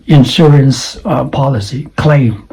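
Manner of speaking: normal talking voice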